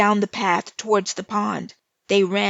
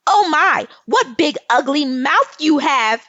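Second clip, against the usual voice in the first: much higher and louder, jeering; loud taunt; 7 semitones higher, 8 dB louder than usual. Acted. Lil Red taunting the wolf about his ugly mouth, loud and high. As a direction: throw the last insult right at him.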